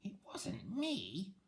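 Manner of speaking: high, very slow witch. Acted. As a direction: drag the denial out, witchy.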